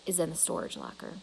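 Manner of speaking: soft and quick, barely above a murmur; quietly. A narrator's reading. Father quietly relenting to his daughter, soft and hushed. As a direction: give in, say it low and hushed. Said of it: "he says quietly".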